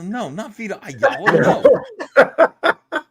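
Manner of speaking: loud, high, laughing protest; high-pitched laughing veto; laughing refusal. Real, not acted. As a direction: blurt it out loudly through laughter. Laughing, emphatic joking refusal.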